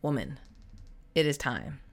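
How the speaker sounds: low, soft voice of intuition; inner intuition. Retold or imitated. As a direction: speak softly, like a knowing inner voice. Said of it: "My intuition was calling me and telling me".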